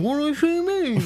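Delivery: high-pitched, hammed-up confusion; mock bewilderment. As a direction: whine it high, imitating a baffled kid. Retold puzzling alone in room.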